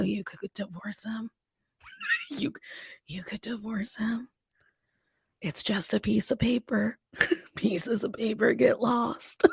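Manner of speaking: whispered, conspiratorial pitch to a friend; secret whisper. Retold or imitated. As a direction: lean in and whisper the temptation. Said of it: "I go".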